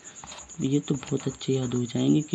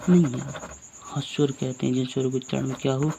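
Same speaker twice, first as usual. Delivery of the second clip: ordinary talk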